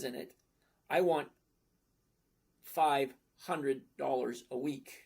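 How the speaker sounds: slow, deliberate spelling-out of the numbers; said slowly. Shown as a demonstration. Explaining the math to a chat viewer, deliberately slow. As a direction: lay it out word by word for him. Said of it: "I'm gonna say it slowly".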